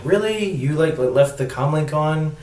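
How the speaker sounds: high, disbelieving "Really?" that scoffs; mock him for leaving the comlink on; a light imitation of a scolding partner; incredulous. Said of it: "He's like".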